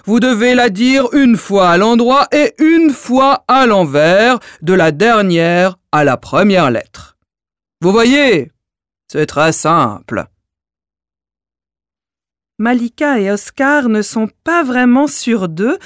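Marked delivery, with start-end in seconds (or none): 0.1-10.3 dim giant. Acted. Giant explains.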